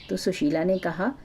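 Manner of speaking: plain reading voice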